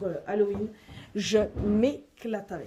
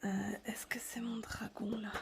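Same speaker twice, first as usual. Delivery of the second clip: whisper; 8 dB quieter than usual. Real, not acted. Showing her coloring books to viewers, whispered. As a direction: whisper so as not to wake anyone.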